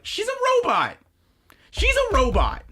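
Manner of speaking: high-pitched yelled revelation; hammed-up, very high outburst; exclaiming. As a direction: shout it like a stunned discovery. Retold outburst at the discovery.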